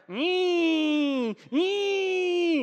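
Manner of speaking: high mock cat screech. Preacher imitating the cornered cat hissing, high screech. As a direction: shriek like an angry cat. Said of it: "the cat was like".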